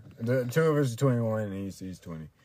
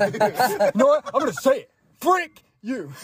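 mock defiance; 11 semitones higher, 5 dB louder than usual. Mock defiant outburst at friend.

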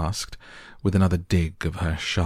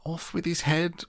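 much higher mock shout; Queen sentences players, much higher; 12 semitones higher than usual. A narrator's reading. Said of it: "quarrelling ... and shouting".

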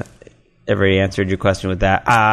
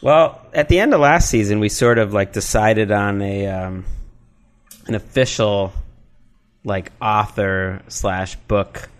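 ordinary delivery

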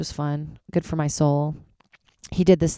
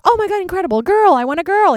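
high, loud squeal of delight; thrilled retold reaction; excited gush; 14 semitones higher, 7 dB louder than usual. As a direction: gush like you just won. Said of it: "I'm like".

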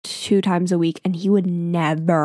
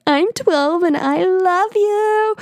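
put-on much higher, slow, breathless little girl; hyperventilating twelve-year-old; 11 semitones higher, 3 dB louder, slower than usual. Twelve-year-old confessing love to the YouTuber on the radio, high and slow. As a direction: squeak it out like a starstruck kid. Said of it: "like, hyperventilating".

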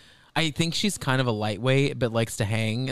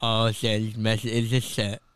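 imitating a tipsy, slurred lisp; mock drunken mumble with slushy s sounds; drunk lisping impression; 2 semitones lower than usual. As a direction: slur it like a drunk woman with a lateral lisp. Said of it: "enhances when she says she's drunk".

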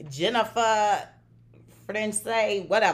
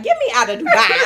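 laughing plea; 8 semitones higher, 10 dB louder than usual. Retold or imitated. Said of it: "I was just like".

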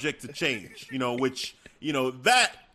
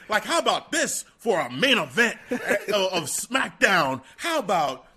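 higher, indignant rant; wide-eyed Heyman; 5 semitones higher than usual. Retold or imitated. Paul Heyman ranting at Adam Pearce, higher and indignant. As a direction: puff up, mock outrage. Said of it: "Paul Heyman's like, you know, wide-eyed".

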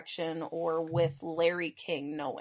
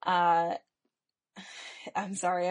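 ordinary talk

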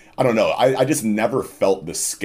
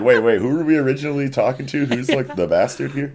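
confused crew; 8 semitones higher than usual. Retold or imitated. Confused crew voiced, shifted voice.

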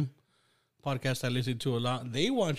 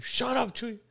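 mock scolding mom; 10 semitones higher than usual. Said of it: "my mom's like".

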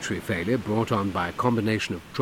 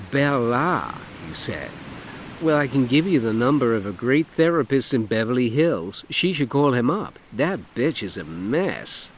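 camp it up, lilting and showy; flamboyant man; 5 semitones higher, 4 dB louder than usual. Acted. Dougie voiced in story.

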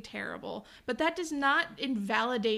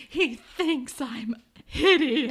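loud, drawn-out fake anguish; hammed-up slow wail; mock wail; 2 semitones higher, 8 dB louder, much slower than usual. [name] despairs after a boy's compliment, mock wailing.

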